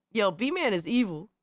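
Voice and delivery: ordinary talk, nothing marked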